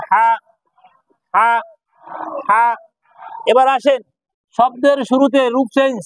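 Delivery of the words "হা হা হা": ha pressed out from mid-throat; squeezed ha. Shown as a demonstration. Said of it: "চেপে বলেন". (গলা চেপে হা বলা)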